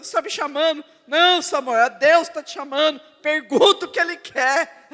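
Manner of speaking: loud, high calling out to the crowd. Real, not acted. Preacher loudly telling the church to ask God, like Samuel. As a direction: proclaim it, almost shouting.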